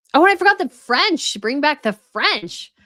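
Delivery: a burst of recall turning into a rallying call; sudden excitement. Real, not acted. Suddenly remembers the French royals.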